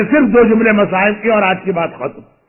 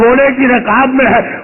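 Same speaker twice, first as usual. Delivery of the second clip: normal talking voice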